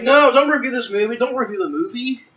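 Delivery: high, pleading voice of the alarm clock. Pretend. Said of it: "It's like".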